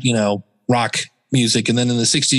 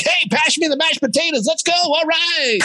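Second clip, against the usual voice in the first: high, hammed-up announcer hype; hyped podcast host. Host mocking that guy at dinner, over-the-top hype. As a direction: bellow small talk like a show intro. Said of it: "if I go to dinner with that guy".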